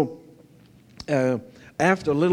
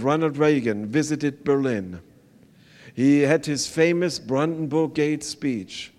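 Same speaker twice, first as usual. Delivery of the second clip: ordinary talk, nothing marked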